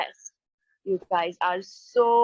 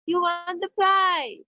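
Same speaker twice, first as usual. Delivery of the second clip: higher, slower, thrilled reveal; excited announcement; 7 semitones higher than usual. Shown as a demonstration. Teacher reads an exclamatory example to the class, thrilled. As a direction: announce the win with delight.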